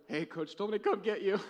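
giggly retelling of the line; laughing through quote. Retold or imitated. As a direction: quote the kid, half laughing. Quoting himself, laughing.

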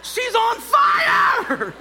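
high, loud, very slow shout of alarm; stretched-out cry of alarm, half laughing. Retold or imitated. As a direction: yell it out in laughing disbelief. Seeing the lady's hair burning.

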